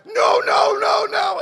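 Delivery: high loud pleading nos; frantic high-pitched no no no; repeated shouted no. Retold or imitated. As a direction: hold him off, shouting no over and over. Pushing drunk neighbour against wall.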